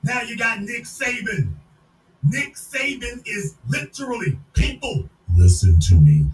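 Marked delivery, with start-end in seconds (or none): 5.3-6.2 very loud, low bark; booming shout. Real, not acted. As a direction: command them to listen. Shouting at audience.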